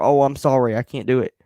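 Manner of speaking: loud, sarcastic fake sorry; mock apology. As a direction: parody the hollow apology. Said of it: "I get more mad when somebody's like".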